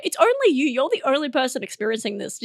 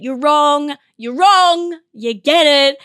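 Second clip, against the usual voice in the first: louder, insisting you're wrong; insistent arguing; 2 semitones higher, 7 dB louder, slower than usual. Acted. Acted mid-argument scene, louder insistent "you're wrong". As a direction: hammer home the point in a mock argument. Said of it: "That was a full scene right there".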